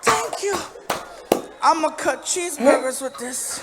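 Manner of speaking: high, loud mock-drunk delight; hammed-up tipsy thrill; drunken gratitude. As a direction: gush like a plastered kid with a present. Drunk comedian thanks for knife gift.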